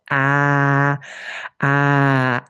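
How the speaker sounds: long, held low-middle "ah"; low middle vowel. Shown as a demonstration. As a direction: sustain a steady open ah. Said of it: "a low middle".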